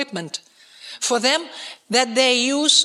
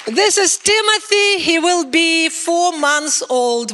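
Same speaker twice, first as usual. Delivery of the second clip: proud excited; 7 semitones higher, 6 dB louder, slower than usual. Real, not acted. Proudly shows grandson picture.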